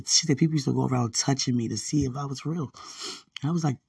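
normal talking voice

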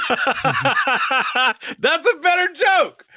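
squeaky laugh through words; laughing high voice. Real, not acted. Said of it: "if there's one thing I'm known for, it's timing".

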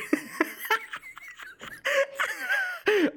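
long high-pitched laugh; helpless cracking up; laughing fit. Real, not acted. Laughs at being proved wrong.

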